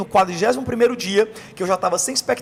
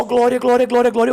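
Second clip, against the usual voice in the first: loud, higher glória repeated; fervent praise shout; 6 semitones higher, 5 dB louder than usual. Retold or imitated. Convert in the prayer circle, shouting glória loudly. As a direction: cry out glory in the prayer circle. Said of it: "E eu só".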